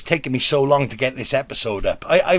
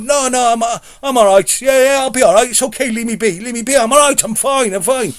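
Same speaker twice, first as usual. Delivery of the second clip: brushing it off; 7 semitones higher than usual. Retold or imitated. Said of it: "And I'm like".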